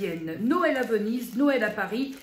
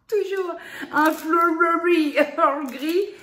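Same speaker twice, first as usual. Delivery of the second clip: a louder, higher, slow line full of laughter; laughing; 6 semitones higher, 8 dB louder, slower than usual. Real, not acted. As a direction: keep laughing through the tea's name. Still laughing at the name. (en riant franchement, plus fort et plus aigu)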